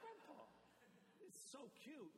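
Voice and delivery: put-on high little-kid voice; lightly voiced high toddler. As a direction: squeak it like a toddler. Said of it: "He goes".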